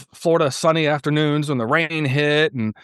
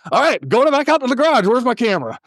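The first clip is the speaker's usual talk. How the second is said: renewed eagerness; 10 semitones higher, 3 dB louder, faster than usual. Retold or imitated. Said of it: "And it's like".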